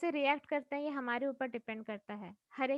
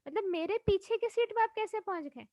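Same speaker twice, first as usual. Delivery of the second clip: surprised question; 5 semitones higher, 5 dB louder than usual. Retold or imitated. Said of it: "मैंने कहा".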